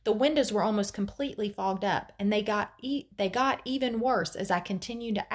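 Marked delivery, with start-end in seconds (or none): none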